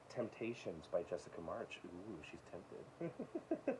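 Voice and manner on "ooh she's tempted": much softer, very slow teasing coo; a playful, hushed, drawn-out tease; teasing sultry aside. Pretend. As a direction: breathe it out like a mock temptress. Teasing a book title.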